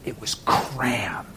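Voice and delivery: hushed and deliberate, with long pauses; intense hush. Real, not acted. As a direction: drop to a grave whisper on the cruelty. Dramatic hush after describing the thorns.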